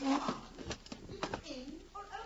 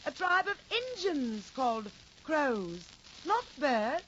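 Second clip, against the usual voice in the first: loud, projected stage declamation; theatrical stage actress; 4 semitones lower, 10 dB louder than usual. Acted. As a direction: play it big for the back row. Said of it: "And the actress answers".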